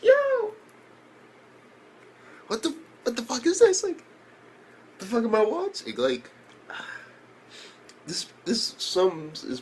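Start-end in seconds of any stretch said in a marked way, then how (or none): 0.0-0.5 loud, very high squealed exclamation; shocked outburst. Retold or imitated. Viewer reacting to a giant fat dude, shocked. 2.5-5.9 high, squeaky mock bewilderment; baffled disbelief. Viewer reacting to a giant fat dude, baffled.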